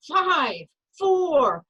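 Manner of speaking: put-on loud, high, gleeful countdown to the kids; excited countdown. Mother thrilling her kids in the elevator, loud excited countdown. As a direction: count down like a rocket launch. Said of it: "and I say".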